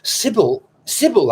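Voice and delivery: ordinary delivery, nothing marked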